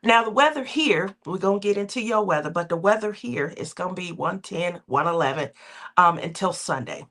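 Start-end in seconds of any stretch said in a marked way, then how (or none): none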